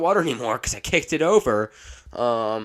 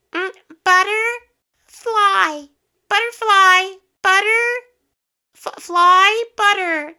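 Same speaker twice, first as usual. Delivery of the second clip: mock filtered voice, much higher, slow and loud; voice changer singsong; 11 dB louder, much slower than usual. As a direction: sing it like a squeaky voice filter. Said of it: "I don't wanna listen to".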